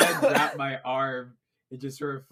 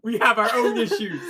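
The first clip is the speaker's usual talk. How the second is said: mock comforting; 8 semitones higher, 7 dB louder than usual. Consoling the orc mid-hug.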